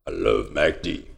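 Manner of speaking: very deep, soft soul-singer rumble. Acted. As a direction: drop to the bottom of your range like a soul crooner.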